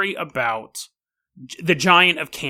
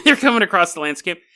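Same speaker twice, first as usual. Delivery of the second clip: usual speaking voice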